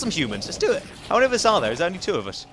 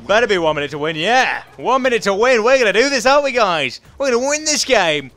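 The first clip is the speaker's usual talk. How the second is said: louder, higher hype; rallying cheer; 4 semitones higher, 6 dB louder than usual. Real, not acted. Gamer hyping teammates with a minute left. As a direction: fire up the team.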